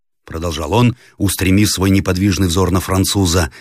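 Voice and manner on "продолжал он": ordinary delivery, nothing marked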